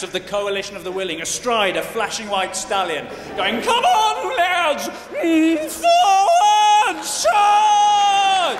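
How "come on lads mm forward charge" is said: very high, very loud rallying shout; battle cry. Retold or imitated. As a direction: rouse the troops from horseback. Queen leading troops into battle.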